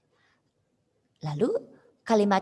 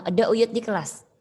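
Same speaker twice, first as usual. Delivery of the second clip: class leader's firm, louder order; firm command; 3 semitones higher, 6 dB louder than usual. Acted. Class leader telling classmates not to be noisy, firm command. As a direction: put it sharply, as an order to the class. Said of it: "dengan tegas si ketua kelas bilang".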